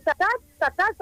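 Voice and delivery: a hint of a toddler begging for the breast; baby talk. Cousin imitating the baby asking its mother, mild baby voice.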